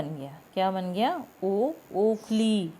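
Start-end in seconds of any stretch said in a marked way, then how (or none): 1.4-2.7 put-on teacher drawing out ओ for young pupils; slow drawn-out demo. Shown as a demonstration. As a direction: stretch ओ very slowly, like to small children. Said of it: "छोटे बच्चों की तरह बोल बोल के करो".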